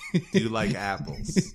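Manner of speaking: put-on lightly done Boston accent; a hint of Boston on apples; playful Boston accent; mock Boston accent. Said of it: "say Ohio words in a Boston accent".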